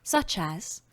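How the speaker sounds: ordinary delivery, nothing marked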